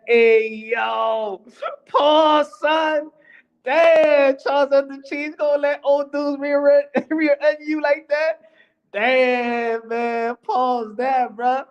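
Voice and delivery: high-pitched outcry, cracking up; laughing disbelief. Real, not acted. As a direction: tease him through bursts of real laughter. Cracks up at a double meaning.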